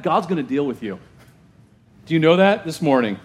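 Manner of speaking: normal talking voice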